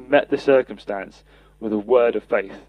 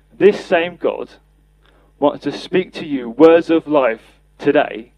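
slow emphasis; 4 dB louder, slower than usual. Real, not acted. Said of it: "preaching emphasis slowed".

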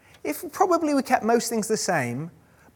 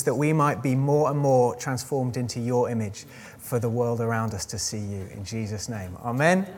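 soft, hushed prayer voice; gentle earnest church prayer; 4 semitones lower, 3 dB quieter than usual. Real, not acted. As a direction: pray quietly and earnestly to God. Leads an opening prayer.

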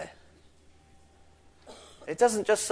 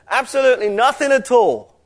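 every word of "Absolutely nothing at all" driven higher and louder; pressing the point; 6 semitones higher, 5 dB louder than usual. Shown as a demonstration. Preacher showing Gabriel's even stronger "Absolutely nothing at all", emphatically pressed. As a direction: punch out "Absolutely nothing at all" with extra force. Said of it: "Gabriel is really pressing the point".

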